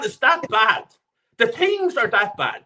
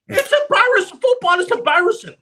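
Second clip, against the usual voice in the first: exasperated outburst; 9 semitones higher, 4 dB louder than usual. Real, not acted.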